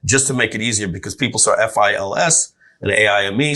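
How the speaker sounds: usual speaking voice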